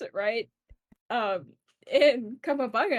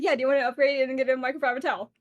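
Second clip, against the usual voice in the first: rapid upsell; faster than usual. Retold or imitated. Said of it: "the girl's like".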